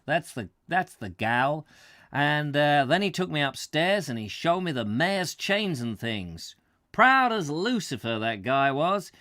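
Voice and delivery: put-on light New England American accent, lively. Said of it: "differentiate between Morton talking, me talking, and the American talking".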